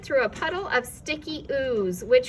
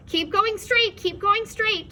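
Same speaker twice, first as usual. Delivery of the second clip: higher, louder guiding call; calling counsel; 10 semitones higher, 5 dB louder than usual. A narrator's reading. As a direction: steer them from a distance. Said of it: "counseled the voice".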